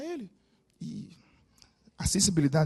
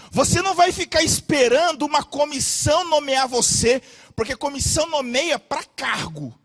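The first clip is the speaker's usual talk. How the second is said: louder, higher, slowing at the end; fiery preaching; 6 semitones higher, 8 dB louder than usual. Real, not acted. Preacher stirring the congregation, loud and fiery. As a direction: drive it home from the pulpit.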